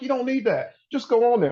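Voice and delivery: high-pitched, insistent imitation of a friend; imitated friend egging him on; urging friend. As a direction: brush off the objection, voice pitched high. Acts out friends urging him online.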